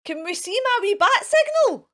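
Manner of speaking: a proud little gasp of delight; excited reveal. Real, not acted. Teacher showing the children her homemade bat signal, excited.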